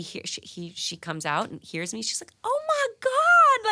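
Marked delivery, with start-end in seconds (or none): 2.4-3.7 loud, high, drawn-out Louisiana gush; imitated Louisiana squeal, stretched out; Louisiana accent. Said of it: "in her Louisiana accent".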